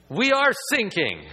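high, loud preached punchline; loud emphatic preaching line; emphatic cry. Acted. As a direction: drive the point home with a cry. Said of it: "preacher repeats the call".

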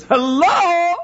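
high, loud, bright hello; snatch up the phone and sing out hello; eager phone hello. Retold or imitated. Daughter dashes to the phone, high eager hello.

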